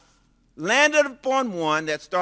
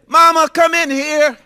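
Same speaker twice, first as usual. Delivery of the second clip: mock loud call, much higher and louder; a girl just proposed to calls her mother in, loud and high; calling out to mama; 12 semitones higher, 12 dB louder than usual. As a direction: shout for mom from the kitchen.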